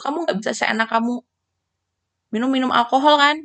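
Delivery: ordinary delivery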